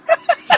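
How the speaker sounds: high, hammed-up villain cackle; maniacal laugh. Vampire woman cackling as she takes flight. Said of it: "The maniacal laughter filled the house".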